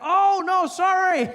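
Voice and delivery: high, loud, hammed-up apology; mock apology. Driver pulling up alongside, exaggerated mock apology. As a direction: gush a fake sorry.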